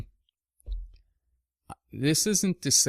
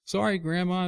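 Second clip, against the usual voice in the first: put-on higher, sheepish little-boy apology; sheepish kid; 8 semitones higher than usual. Boy apologizing to grandmother, mock sheepish. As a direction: sound like a caught kid. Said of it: "I'm like".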